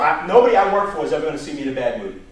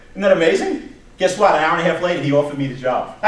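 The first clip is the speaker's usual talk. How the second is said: ordinary delivery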